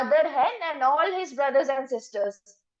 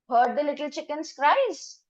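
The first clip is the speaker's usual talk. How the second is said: ordinary delivery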